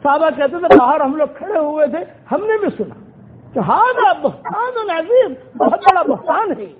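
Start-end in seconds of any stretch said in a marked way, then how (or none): 3.7-6.7 higher, slow, ringing declaration; loud outcry. Retold or imitated. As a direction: call it out slowly so it carries outside the door. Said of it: "یہ جملہ زور سے کہا".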